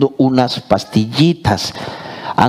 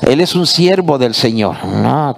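usual speaking voice

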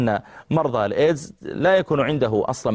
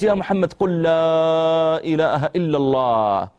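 pleading; 5 semitones higher, slower than usual. Retold or imitated. Doctor urging dying youth.

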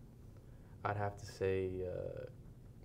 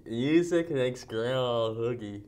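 slow, mushy words round a guard; mouth guard speech; slower than usual. Real, not acted. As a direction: speak around a mouth guard.

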